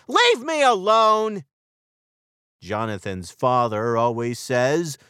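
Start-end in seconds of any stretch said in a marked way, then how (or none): 0.1-1.4 high, loud snappy yell; boy yelling at his sister to leave him alone. Acted. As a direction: shout it to make her go away. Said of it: "he yells".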